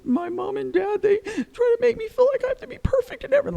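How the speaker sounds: high-pitched mock tearful wail; hurried, high, weepy child impression; imitating crying child. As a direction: play a little kid blurting it out through tears. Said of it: "he's just crying".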